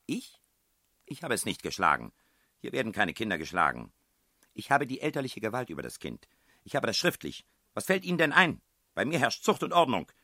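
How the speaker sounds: loud, high defensive outburst; indignant woman. A narrator's reading. Headmistress denies beating children, loud rising indignation. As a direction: rise from denial into outraged authority.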